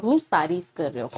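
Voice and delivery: usual speaking voice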